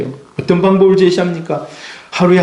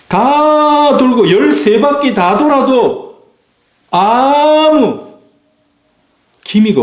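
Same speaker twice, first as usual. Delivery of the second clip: drawn-out suspense; 8 semitones higher, much slower than usual. Acted. Preacher slows right down to build suspense, high and drawn out. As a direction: stretch each word, much slower and higher. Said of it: "drawn out for suspense".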